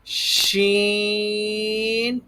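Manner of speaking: شین opened with a long sh, vowel held high; sh first, then sustain شین on one note; long sh sound. Shown as a demonstration. Teacher models the sound for pupils, drawn-out شین. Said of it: "اس طرح نکالیں گے تو".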